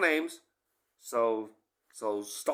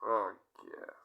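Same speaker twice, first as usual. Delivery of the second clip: dismayed inner groan; 8 semitones lower, 10 dB quieter, much slower than usual. Retold or imitated. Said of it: "And I'm like".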